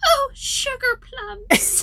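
put-on high, slow old lady exclamation; imitated old lady's dismay; old woman. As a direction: draw out a mild curse in old-woman falsetto. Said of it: "She goes".

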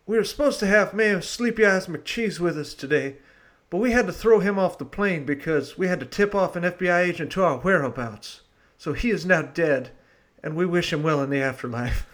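hosting in a hammed-up Southern accent. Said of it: "the last of my Southern accent".

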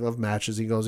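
ordinary talk, nothing marked

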